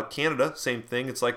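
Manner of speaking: normal talking voice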